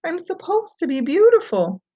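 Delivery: high, bewildered princess voice; Fiona's voice. Acted. Fiona to Shrek after the spell fails, high and bewildered. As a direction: speak like a confused princess.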